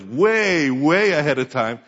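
WAY, WAY stretched out slow and strong; drawn-out emphasis. Real, not acted. Preacher stressing God's early plan to congregation, drawn-out emphasis on "way". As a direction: lean long and hard on each "way".

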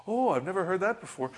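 high-pitched, hammed-up wonder; mock awe. Believer impressed by a medium, mock amazement.

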